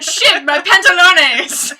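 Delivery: loud, high, slow mock Spanish accent yell; a gamer's goofy Spanish-accent outburst. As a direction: belt it out in a hammed-up Spanish accent. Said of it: "he yells out in this Spanish accent".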